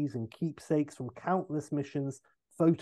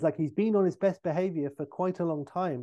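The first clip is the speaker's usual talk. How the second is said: ordinary talk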